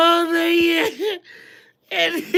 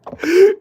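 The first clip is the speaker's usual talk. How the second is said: sudden high laugh, loud; burst of laughter; 7 semitones higher, 6 dB louder than usual. Real, not acted. Reacting to a funny comment.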